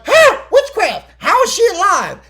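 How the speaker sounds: loud, high-pitched mock outrage; retold shocked outcry. Lutan confronts Picard over the woman being alive, hammed-up outraged shout. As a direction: yell it as a hammed-up accusation.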